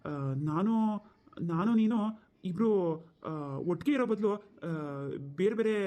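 broken delivery with long gaps; hesitant, halting. Acted. Younger brother nervously broaching separation to his elder brother, hesitant. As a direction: feel out each phrase, pausing and filling with "aa".

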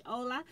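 "hola" without the H; silent H. Shown as a demonstration. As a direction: say "hola" as OH-la, opening on the vowel. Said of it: "I pronounced the H".

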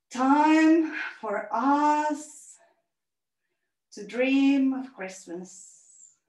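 gentle meditation voice, fading to a whisper; calm closing relaxation. Acted. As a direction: settle the children into stillness.

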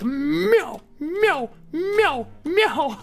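high, slow, rising meows; cat meow. Retold or imitated. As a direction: meow like a cartoon kitten. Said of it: "it's like".

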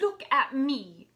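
loud, slow, high insistence; indignant demand. Acted. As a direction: demand to be looked at, outraged. She demands he look at her.

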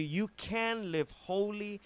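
ordinary talk, nothing marked

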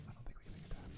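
whisper. Real, not acted. Quiet aside while crafting.

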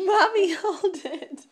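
laughing loudly through the words. Real, not acted. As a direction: burst out laughing while talking. Parent laughing while echoing child.

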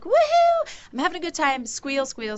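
high, gleeful whoop settling into a singsong; imitating a happy kid's high-pitched squealing. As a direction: squeal like a kid having a great time. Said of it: "he loves this high-pitched noise".

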